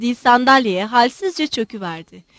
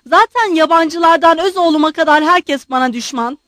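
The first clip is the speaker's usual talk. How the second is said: loud, raised-pitch rant; shouting tirade; 5 semitones higher, 7 dB louder than usual. A narrator's reading. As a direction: keep hollering insults and grievances. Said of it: "General bağırıp duruyordu".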